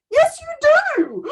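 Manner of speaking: emphatic retort. Retold or imitated. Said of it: "I'm like".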